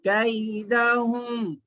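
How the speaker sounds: كيدهم slowly with a clear a-i glide; maroof vowel, correct. Shown as a demonstration. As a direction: draw out كيدهم as "kai-dahum". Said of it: "मारूफ आवाज से पढ़ना".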